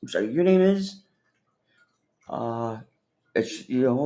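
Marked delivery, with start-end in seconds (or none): 0.0-1.0 asked much higher, politely puzzled; high, apologetic question. Shown as a demonstration. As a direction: lift it like you missed her name. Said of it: "gotta give her that little".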